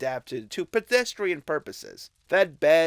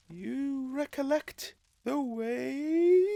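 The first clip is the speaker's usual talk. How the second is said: high, slow, otherworldly spirit; ghostly spirit voice; 12 semitones higher, slower than usual. Acted. Ghost of Christmas Past solemnly summoning Scrooge, high and slow. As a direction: speak from beyond, high and drawn out.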